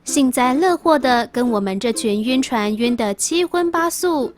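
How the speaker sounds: ordinary talk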